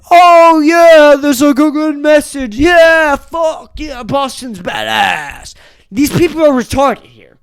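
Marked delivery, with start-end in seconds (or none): 0.1-5.5 loud, high, excited cheer; naive kid cheering. Retold or imitated. Eleven-year-old self cheering at the news, loud and high. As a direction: hype it up like a clueless kid. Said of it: "I was like".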